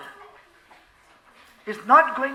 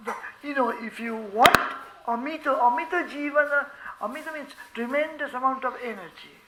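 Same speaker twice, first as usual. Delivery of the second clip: ordinary talk, nothing marked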